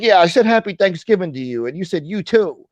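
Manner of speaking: mock his grievance high and loud; mocking imitation. Streamer imitating the man's Thanksgiving complaint, mockingly high.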